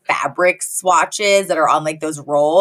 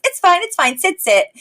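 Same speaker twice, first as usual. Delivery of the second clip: much higher, brushing it off like a tired mom; martyred mom; 11 semitones higher than usual. Retold or imitated. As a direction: wave them off like the mom who does everything. Overworked mom brushing off help.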